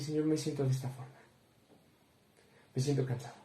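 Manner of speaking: soft, tired confession to God; hushed, worn-out prayer; quiet weary prayer. Retold or imitated. Praying alone, telling God he is tired, softly.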